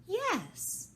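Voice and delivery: high, loud yes; Alice shouts back to the Queen, high; shouting Alice. A narrator's reading. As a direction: call out eagerly. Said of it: "shouted Alice".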